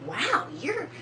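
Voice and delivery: high excited staff voice, wow rising; impressed doctor. Retold or imitated. Nurses praising her fertility, in high excited wonder. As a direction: gush over how much she's growing. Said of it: "They was like".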